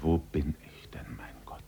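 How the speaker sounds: breathless whisper fading to nothing; whispered thought. A narrator's reading. Man face in the wet grass, whispering to himself. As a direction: whisper it to yourself in despair. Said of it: "dachte er".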